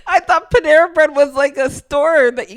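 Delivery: high and laughing. Real, not acted. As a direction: explain while giggling. Explains while laughing.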